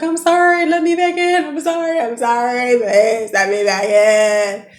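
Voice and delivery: high, loud pleading to be let back in; frantic begging. Retold or imitated. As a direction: beg through the door, voice way up. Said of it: "Just acting a fool".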